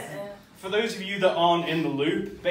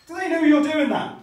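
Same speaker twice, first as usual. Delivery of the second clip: a touch higher and louder, earnest; honest question; 7 semitones higher, 6 dB louder than usual. Retold or imitated. Neighbour asking the painter, lightly earnest. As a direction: ask it with sincere puzzlement. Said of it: "asks me a very honest question".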